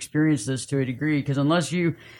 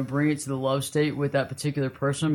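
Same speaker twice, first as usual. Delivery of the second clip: ordinary delivery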